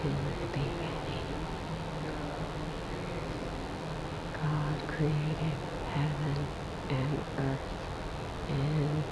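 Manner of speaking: soft whispered recitation of the verse; near-silent whisper. Shown as a demonstration. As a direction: whisper it almost without sound. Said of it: "start it with a silent whisper".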